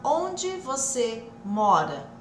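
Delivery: slow, separated words like a translation app; robotic word-by-word demo; parecendo o Google. Shown as a demonstration. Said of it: "Tá parecendo o Google lá". (devagar, separado, tipo Google)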